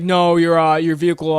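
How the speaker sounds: loud, overbearing cop refusal; mimicked cop. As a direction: cut him off loudly as the officer.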